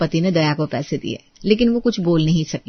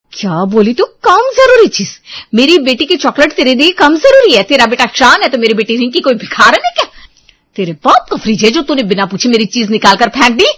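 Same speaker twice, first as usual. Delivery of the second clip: much higher and louder, spitting the words back; woman yells at sister-in-law over the fridge; outraged yell; 4 semitones higher, 7 dB louder than usual. Acted. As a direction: throw her words back at her in fury. Sisters-in-law quarrel over chocolate.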